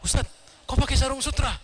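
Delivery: high-pitched mock objection; acted indignant query; challenging question. Student challenges teacher over silk sarong.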